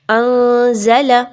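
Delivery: अंज़ला with the noon hidden, slow and nasal-hidden. Shown as a demonstration. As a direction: say अंज़ला slowly, noon tucked into nose and mouth. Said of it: "नून की आवाज़ को मख्फी करना है". (अंज़ला में नून मख्फी, धीरे)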